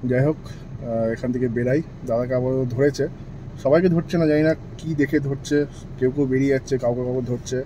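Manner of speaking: hushed and low so the police won't hear; quiet, hidden commentary; speaking softly. Real, not acted. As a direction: keep it low, almost under your breath. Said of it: "আস্তে আস্তে বলছি".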